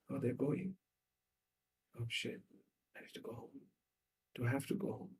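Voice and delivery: very soft, deflated murmur; small, quiet, reluctant voice; dreading going home. Acted. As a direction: mutter to yourself as the cheer drains away. Acted boy dreading going home.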